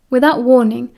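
ordinary delivery, nothing marked

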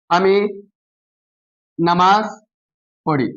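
long pauses between আমি, নামাজ and পড়ি; broken-up reading. Shown as a demonstration. Teacher showing pupils the word-by-word method, long gaps. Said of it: "ভাঙ্গা ভাঙ্গা উচ্চারণ করবেন".

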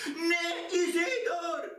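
a high, urgent call of the name. Acted. Mother calling out to her son to stop paying, a high urgent call. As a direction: cry out to stop him, high. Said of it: "zawołałam".

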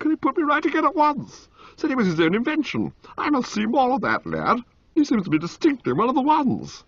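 put-on high, cheerful young toff; Motty voice. A narrator's reading. Motty to Bertie about Jeeves, high and cheery.

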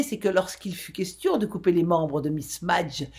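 ordinary delivery

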